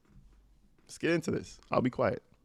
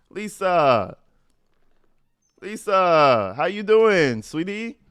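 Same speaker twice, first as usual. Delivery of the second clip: calling out sweetly; 4 semitones higher, 5 dB louder, slower than usual. Pretend. Greeting the woman in the messages.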